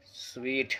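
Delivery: "स्वीट" said slowly like the word sweet; correct pronunciation. Shown as a demonstration. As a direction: say "स्वीट", not "सूट". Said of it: "इसको पढ़ा जाता है". (सही उच्चारण "स्वीट", धीरे से)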